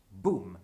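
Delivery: loud, low imitated boom; boom noise. Picasso painting nightfall, loud mock boom. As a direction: drop a heavy vocal boom. Voicing the painter's strokes aloud.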